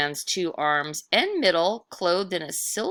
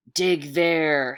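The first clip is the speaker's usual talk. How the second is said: louder, stern ghost order; ghost's command; 5 dB louder than usual. Acted. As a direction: order the boy to dig. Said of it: "he commanded".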